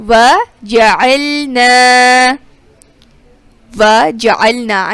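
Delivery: وَجَعَلْنَا with a throaty ع, not a glottal stop; clear ain. Shown as a demonstration. Teacher contrasting ain and hamza in وَجَعَلْنَا. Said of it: "عین کی آواز میں اور حمزہ".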